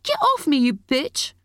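put-on high-pitched angry teenage cry; bursting out. A narrator's reading. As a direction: throw it at her in a high outburst. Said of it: "Molly burst out".

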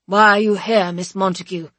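loud, imposing interrogators; stern board. Acted. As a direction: demand it like a hostile panel. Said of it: "the board asking me why".

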